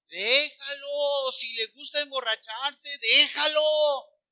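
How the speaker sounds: mock permissive parent. Parent excusing son's drunkenness, mock permissive. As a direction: stretch it out in mocking indulgence. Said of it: "¿quién de ustedes dice".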